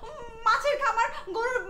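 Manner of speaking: high-pitched, upset protest; worked-up, pleading high voice; distraught insistence. Acted. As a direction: insist desperately that it was all real. Insisting her lost wealth was real.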